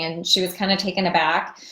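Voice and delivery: usual speaking voice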